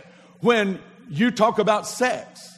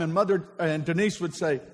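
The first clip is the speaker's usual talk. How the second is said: usual speaking voice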